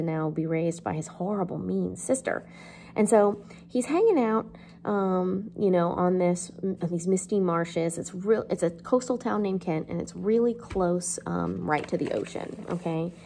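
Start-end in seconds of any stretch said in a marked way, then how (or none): none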